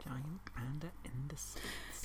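slow, breathy stage whisper; dramatic whisper. Pretend. Whispering the fact back to the host, slow and dramatic. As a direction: hush it out like a secret reveal.

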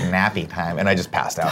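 a touch of a dopey bedtime voice; nappy time voice. Pretend. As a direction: lightly mumble it like a drowsy toddler. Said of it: "God, I hate when you use that voice".

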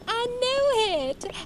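high, slow, drawn-out squeal of triumph; delighted exclaim. Acted. Southern lady thrilled she guessed his hometown, high delighted crow. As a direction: crow over the right guess, stretched high.

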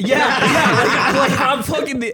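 laughing, high and loud; cracking up at himself; laughing at his own blunder. Real, not acted.

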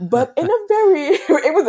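breaking into laughter, pitch jumping high and loud; laughing. Real, not acted. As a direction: laugh through the words as you start the sentence. Laughing while recounting stress.

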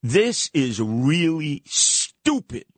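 "stupid" drawn out slowly with a big S; slow verdict, leaning hard on "stupid"; stress on stupid. Real, not acted. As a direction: stretch and punch the start of "stupid". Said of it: "with a capital S".

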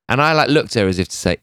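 normal talking voice

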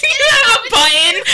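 high, loud laughing squeal; shrieking with delight; excited squeal. Real, not acted. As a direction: squeal it out with glee.

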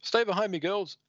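high, urgent voiced command; protective hero. Leviathan shielding the triads from fire, high urgent call. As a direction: call it out pitched well up.

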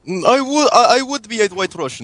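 fake Russian accent. Prank caller phoning hosts in fake Russian accent. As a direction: play a hammed-up Russian caller. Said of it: "was a good Russian accent".

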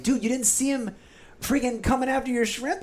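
high, incredulous retort; 1 guide's incredulous voice. Retold or imitated. Guide scolding the angler for missing the fish, high and incredulous. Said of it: "and Wes is like".

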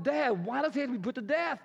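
anguished appeal; pleading son. Acted. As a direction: beg the raging father. Jonathan pleads with Saul.

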